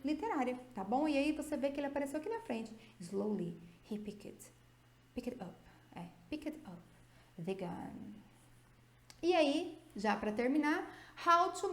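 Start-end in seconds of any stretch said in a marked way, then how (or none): 3.0-8.1 hushed dramatic read. Shown as a demonstration. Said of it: "rereads example sentence for effect".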